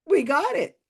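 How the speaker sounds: high-pitched gleeful squeal; mock triumph. YouTuber mocks psychic's lucky hit, high triumphant squeal. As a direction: squeal it like a big win.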